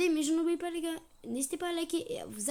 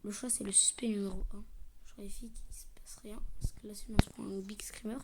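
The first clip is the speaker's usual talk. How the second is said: tense fearful whisper; scared horror game streamer; 3 semitones lower, 6 dB quieter than usual. Real, not acted. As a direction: whisper nervously, bracing for a scare. Fearing a jumpscare in horror game.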